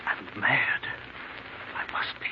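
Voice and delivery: breathless, hushed whisper to himself; terrified man whispering to himself alone in the dark; frightened whisper. Acted. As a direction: whisper it like a man losing his mind. Ambrose panics alone at night.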